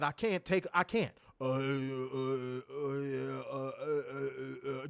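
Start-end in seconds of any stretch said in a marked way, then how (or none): 1.4-4.9 low, slow, soft grunting hesitations; mocking caveman talker. Host mocking the boss's caveman talk, low slow grunts. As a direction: drag out dopey uhs like a caveman. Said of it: "you talk like a fucking caveman".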